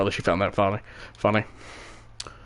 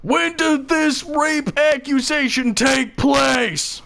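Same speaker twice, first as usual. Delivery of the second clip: angry demand; 13 semitones higher, much slower than usual. Acted. Friend confronting the judge, slow angry shout. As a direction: demand it slowly in anger. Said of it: "standing there, angry".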